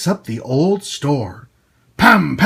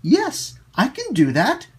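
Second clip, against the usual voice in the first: put-on higher, a light little girl voice; little girl Lucy; 8 semitones higher than usual. Lucy agrees to help, lightly voiced little girl. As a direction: answer eagerly like a small girl. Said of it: "answered Lucy".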